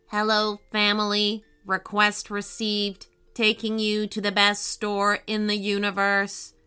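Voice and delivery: even-toned machine voice; talking car, obliging and robotic. Acted. Car confirms the family's request, robotic and measured. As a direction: answer like an onboard computer, on one level note.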